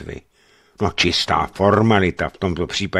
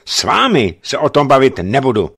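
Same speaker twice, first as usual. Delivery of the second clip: raised, louder brush-off; angry officer refusing to talk; curt anger; 5 semitones higher, 7 dB louder than usual. A narrator's reading. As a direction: shut him down hard. Bennett cuts him off.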